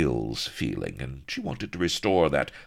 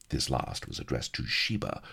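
ordinary delivery

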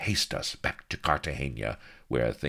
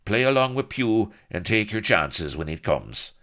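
raised, lilting Irish rogue; Irish rogue bracing for a last stand; Irish brogue; 4 semitones higher, 4 dB louder than usual. Acted. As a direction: brave Irish swagger before a fight. Blood's Irish brogue speech.

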